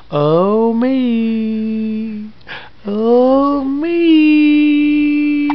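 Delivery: rising mock baby whimper; voicing the baby.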